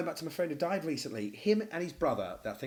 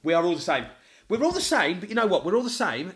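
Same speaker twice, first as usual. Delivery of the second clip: loud, high insistence; raised voice, emphatic; 5 semitones higher, 9 dB louder than usual. Real, not acted. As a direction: hammer home that we're all the same. Emphatic agreement, louder.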